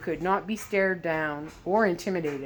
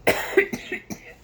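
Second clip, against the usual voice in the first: cough. Real, not acted. Narrator coughs mid-reading.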